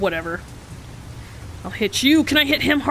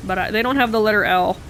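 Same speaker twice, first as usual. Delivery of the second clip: ordinary delivery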